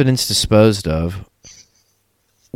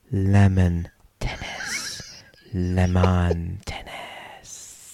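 slow, drawn-out whisper; sultry whisper; 2 semitones lower, 7 dB quieter, much slower than usual. Pretend. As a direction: whisper it very slowly, savouring each word. Savouring the silly story title.

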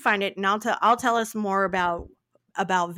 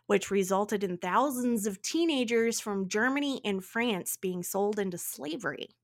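ordinary talk

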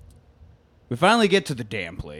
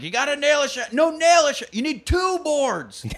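mock yelling cowboy. Everyone in the haberdashery yelling at the newcomer, high mock shout. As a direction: bark the door instructions. Yelled running gag about door.

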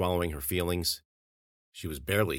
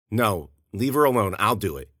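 urgent plea; 4 semitones higher, 7 dB louder than usual. Acted. Ariel protects the girl.

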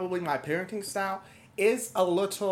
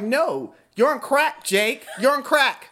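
raised scolding; 7 semitones higher, 6 dB louder than usual. Retold or imitated. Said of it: "I'm like".

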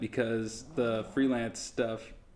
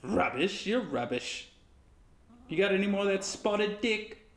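high mock British retort; British accent; 8 semitones higher than usual. Co-host bantering about British food, high put-on British accent. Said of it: "was a British accent".

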